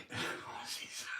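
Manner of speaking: breathy amused whisper to himself; a quiet laughing whisper. Real, not acted. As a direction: whisper it while squinting at the screen. Peering at the phone, amused.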